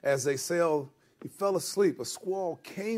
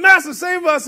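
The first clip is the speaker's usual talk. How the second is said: high, loud cry for rescue; acted yelling; 12 semitones higher, 12 dB louder than usual. One of twelve disciples begging for rescue, yelling. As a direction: yell it over the others. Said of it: "somebody's yelling something".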